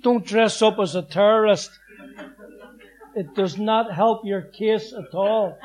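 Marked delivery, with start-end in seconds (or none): 0.0-0.2 loud rallying call to the men in the dock; loud rallying call to fellow prisoners; calling out to the guys. Retold or imitated. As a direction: rouse the gang, loud and firm. Rallying co-accused before trial.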